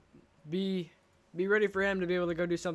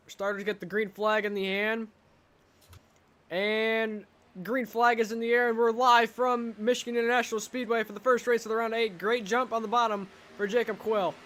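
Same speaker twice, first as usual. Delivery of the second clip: excited play-by-play; 4 semitones higher than usual. Acted. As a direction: call the green flag with energy. Race start call.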